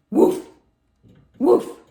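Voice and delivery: loud, high sharp woof; short, loud dog barks; dog barking. A narrator's reading. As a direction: bark it out suddenly. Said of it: "asked the dog".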